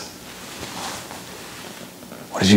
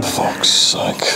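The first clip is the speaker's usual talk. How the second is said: normal talking voice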